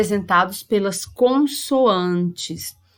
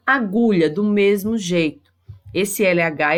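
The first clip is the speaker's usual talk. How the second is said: normal talking voice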